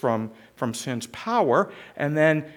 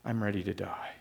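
fading depressed repeat; 7 semitones lower, 11 dB quieter, faster than usual. Acted. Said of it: "Jonah is so depressed, he goes".

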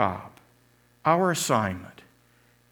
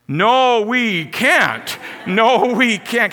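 much higher, louder despairing yell, slow; crowd crying out; 9 semitones higher, 7 dB louder, much slower than usual. Retold or imitated. Losing fans answering the cheerleader, loud despairing cry. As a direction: shout back in defeat. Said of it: "start to cry out".

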